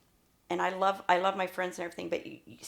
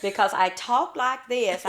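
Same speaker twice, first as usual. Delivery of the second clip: slow, louder hillbilly twang; exaggerated hillbilly speech, pitched higher; hillbilly accent; 5 semitones higher, 6 dB louder than usual. Shown as a demonstration. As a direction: lay on the hillbilly accent, slowly. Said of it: "It was definitely hillbilly".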